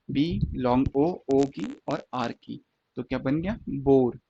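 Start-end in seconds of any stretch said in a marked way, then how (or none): 0.1-2.6 slowly sounding out बी, long ओ, आर; teacher sounding out each part; slow sound breakdown. Shown as a demonstration. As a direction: break the word into sounds, unhurried.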